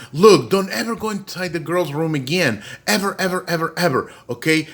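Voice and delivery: high, emphatic mock warning; scolding mother. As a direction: imitate a mom laying down the law. Mom forbids the room.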